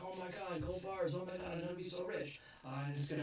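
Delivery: high, fast, giddy over the gold; excited gasp. Retold or imitated. Greedy cop spotting gold bars, high and giddy. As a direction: gasp like you just struck it rich.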